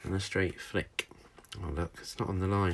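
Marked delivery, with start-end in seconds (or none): none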